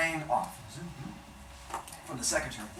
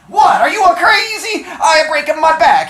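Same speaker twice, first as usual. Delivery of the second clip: put-on loud, much higher accented yell; 15 dB louder than usual. Bookseller yelling at the caller, thick accent, furious. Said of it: "he's yelling at me".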